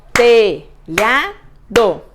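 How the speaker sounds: "Telhado" as three loud, separate beats, te-lha-do; syllable by syllable. Shown as a demonstration. Teacher clapping out syllables for pupils, syllable by syllable. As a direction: punch out each syllable of "Telhado", very slowly. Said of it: "Vamos fazer primeiro batendo palmas".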